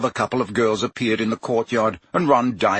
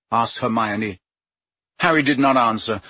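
usual speaking voice

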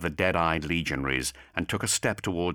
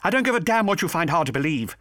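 fast, higher angry snap; snapping; 12 semitones higher, 5 dB louder, faster than usual. A narrator's reading. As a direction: bite the words off in anger. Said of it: "snapped Olivia".

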